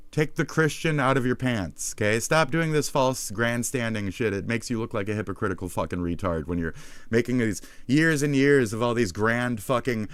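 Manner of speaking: ordinary talk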